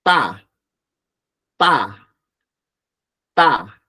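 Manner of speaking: ta said loud and hard, with a sharp hit in the mouth; tense t. Shown as a demonstration. Teacher drills the tense Korean t with the class. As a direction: strike each ta hard. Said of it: "Hacemos el golpe en la boca".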